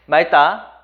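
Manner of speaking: 맑다 said loudly as 말따; ㄹ reading. Shown as a demonstration. Teacher quizzing students with option one, 맑다 clearly said as 말따. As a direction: keep the ㄹ in 맑다, tense the 따. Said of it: "이거 어떻게 읽을까요?".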